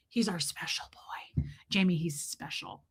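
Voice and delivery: very soft, low half-whisper; hushed aside. Real, not acted. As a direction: confide it under your breath. Confiding aside about the pet.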